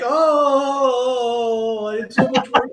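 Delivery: high, drawn-out mock wail of complaint; mock upset whining. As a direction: imitate an upset whiner, stretching the "oh". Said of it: "he's all upset".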